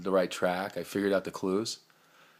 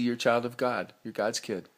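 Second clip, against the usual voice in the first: usual speaking voice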